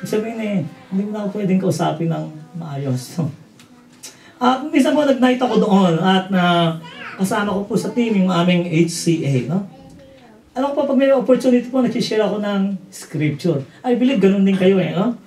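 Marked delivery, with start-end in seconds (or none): none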